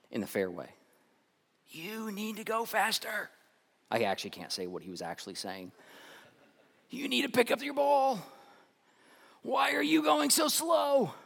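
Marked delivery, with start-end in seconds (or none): 1.7-3.3 high, slow mock yell at slow golfers; a hint of an impatient golfer; angry golfer. Golfer yelling at the group ahead, mock yell. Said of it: "he starts yelling at the people". 6.9-11.1 impatient yelling. Retold or imitated. Golfer shouting at the group ahead, high yell. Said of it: "he starts yelling at the people".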